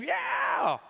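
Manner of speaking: high shouted yeah; a short high shout of triumph; cheering yell. Acted. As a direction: cheer it like a fan. Crowd cheering, acted.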